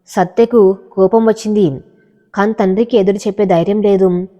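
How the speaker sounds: usual speaking voice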